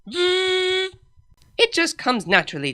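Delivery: slow, high, drawn out; smug. A narrator's reading. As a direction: savour the boast. Luna playing harmonica, proud.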